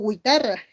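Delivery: "guitarra" wrongly said "güitarra"; the rejected gwi- reading of "guitarra"; sounded U. Shown as a demonstration. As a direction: put a W glide in "guitarra": gwi-ta-rra. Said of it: "yo no digo guitarra".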